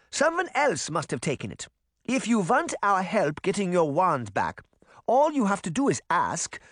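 put-on high, firm accented old woman; indignant granny. Granny warning the witch off her family, indignant.

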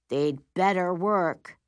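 ordinary delivery, nothing marked

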